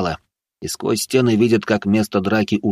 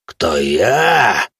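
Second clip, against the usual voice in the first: growling man; 4 dB louder than usual. Acted. Man answers youths' taunt, menacing growl. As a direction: growl swelling on the last word. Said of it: "прорычал мужик".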